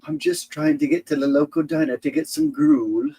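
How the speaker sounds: very high put-on English voice; squeaky mock-English whine; English accent. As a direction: play a hammed-up English pauper. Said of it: "start talking with your English accent".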